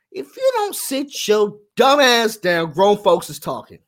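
imitated grandma laying down the law; scolding grandmama. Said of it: "I heard grandmama".

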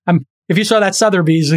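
normal talking voice

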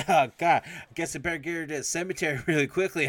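high, loud flustered voice with laughter; fake-panic through giggles; laughing mock panic.

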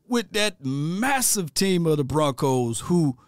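normal talking voice

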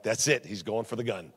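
very low, soft mock alarm; hushed inner thought. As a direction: think it aloud under your breath. Retold secret thought during prayer.

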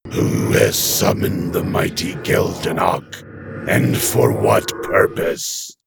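slow, low, whispery demon; a grand demon's slow menace. A narrator's reading.

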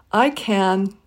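can with a full open a; I can, vowel said fully; strong form can at sentence end. Shown as a demonstration. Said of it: "if it's the last word of the sentence, you can use a full a".

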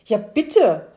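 loud, eager call; loud excited exclamation. Acted. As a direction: shout it across the counter, overjoyed. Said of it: "rief der Mann".

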